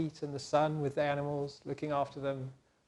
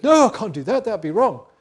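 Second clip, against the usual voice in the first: loud, high, emphatic no; firm refusal; 6 semitones higher, 13 dB louder than usual. Acted. Joseph refusing Potiphar's wife, loud and firm. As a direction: refuse her firmly.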